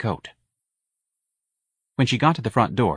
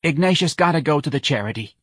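high-pitched, put-upon mother; Mrs. Riley; 7 semitones higher than usual. A narrator's reading. Worried mother on the phone to her friend, high voice.

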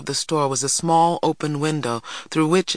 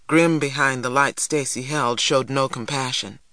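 plain reading voice

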